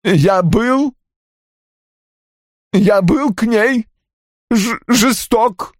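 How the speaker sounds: slow, high, stammering wail; moaned confession. A narrator's reading. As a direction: groan it out haltingly, choking on the words. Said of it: "простонал Рой". (стонущее признание высоким голосом, с запинками)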